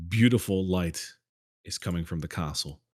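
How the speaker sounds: usual speaking voice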